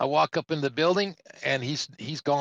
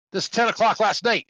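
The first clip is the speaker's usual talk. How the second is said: high, loud accusing bark; mock angry shout; 8 semitones higher, 5 dB louder than usual. Officer challenging the half-asleep trucker, angry raised voice. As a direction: imitate an officer snapping loudly. Acted officer objecting to the slip.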